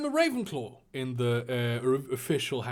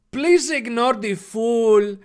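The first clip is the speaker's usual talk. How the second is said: high, loud, drawn-out mock Mexican accent; caricature Mexican accent; 7 semitones higher, 7 dB louder, slower than usual. Draco sneering at Luna, smug caricature Mexican accent. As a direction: drawl it smugly in a hammed-up Mexican accent. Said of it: "like, a really racist caricature of a Mexican".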